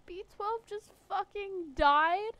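loud, slow disbelief; 1 shocked outcry. Real, not acted. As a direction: shout it in stunned disbelief. Shock at companion's death.

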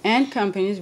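"And" said loud and firm; loud prompt leaning on the word "And"; stress on AND. Shown as a demonstration. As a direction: punch "And" to correct the reader. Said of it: "you need the word and".

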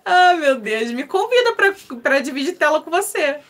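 laughing through the words, loud and high. Real, not acted. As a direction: talk while still laughing at the joke. Laughing at the viewer's joke.

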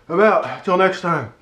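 ordinary talk

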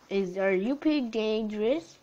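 usual speaking voice

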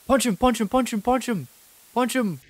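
high loud repeated yelling; frantic shouting. Real, not acted. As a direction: yell the command in a panic. Urging himself on in panic.

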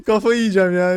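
Kafayı yiyeceğim said loud through laughter; laughing. Real, not acted. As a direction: laugh out the exasperation. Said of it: "Hiç gülesim yoktu".

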